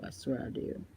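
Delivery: breathy whisper, almost no voice; whispered aside. Real, not acted. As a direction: lean in and whisper it like a secret. Swearing it's true, then repeats.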